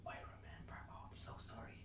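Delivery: hushed, tearful whisper. Retold or imitated. Man under the stars, whispering an apology. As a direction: whisper it like a confession.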